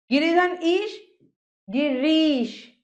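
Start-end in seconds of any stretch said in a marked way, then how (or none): 1.7-2.8 गिरीश said slowly, ree held long; long ई sound. Shown as a demonstration. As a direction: stretch the ee in गिरीश. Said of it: "बड़ी ई". (गिरीश में री को लंबा खींचें)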